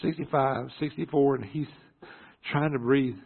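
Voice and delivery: normal talking voice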